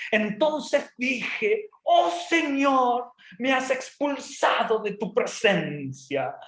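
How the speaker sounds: slow, high, grandiose declamation; pompous prayer. Acted. Jonah praying inside the fish, hammed-up pomp. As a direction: stretch it out like mock-heroic oratory. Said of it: "Yo me imagino toda la pompa".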